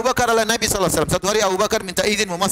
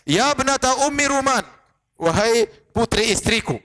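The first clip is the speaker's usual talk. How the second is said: raised, drawn-out mock scolding; angry father; 4 semitones higher, slower than usual. Abu Bakar rebukes his daughter as his wife's child, angry. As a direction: imitate an angry father's slow, stretched-out call. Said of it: "Waktu dia lagi marah".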